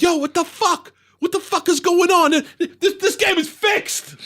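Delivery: high-pitched, outraged imitation of a father; losing his shit. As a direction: rant like a gambler who's being robbed. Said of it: "My father started losing his shit".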